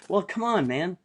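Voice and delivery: high incredulous come on man; exasperated. Real, not acted. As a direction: scoff at their naive plan. Mocking their naive plan.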